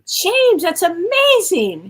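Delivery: amazed. Real, not acted. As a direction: gasp it out in delighted surprise. Co-host reacts to bestseller news.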